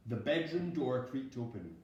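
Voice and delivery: ordinary delivery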